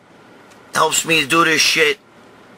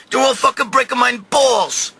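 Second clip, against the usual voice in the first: ordinary talk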